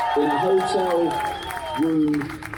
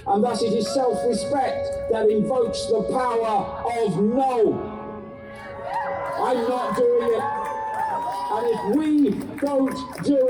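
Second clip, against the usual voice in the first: urgent activist; 6 semitones higher than usual. Acted. Rousing speech to crowd.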